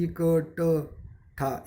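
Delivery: इकट्ठा drawn out very slowly, every syllable separate. Shown as a demonstration. Teacher slowly sounding out इकट्ठा. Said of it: "इकट्ठा यानी जो आखिरी वाला शब्द है".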